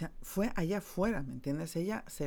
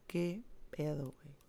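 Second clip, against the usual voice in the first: fading sob; 5 dB quieter than usual. Retold or imitated. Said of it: "de esos llantos de moco".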